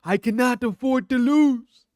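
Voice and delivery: high, loud, slow mock fighter voice; imitating Moicano. Streamer mimicking Moicano's press quote in a loud slow voice. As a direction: ham up the fighter's line. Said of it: "He cannot".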